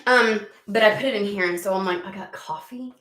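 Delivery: usual speaking voice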